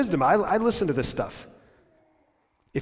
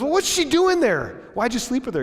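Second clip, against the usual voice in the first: put-on upset girlfriend; 10 semitones higher, 3 dB louder than usual. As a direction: press him hard, higher still. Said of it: "she goes".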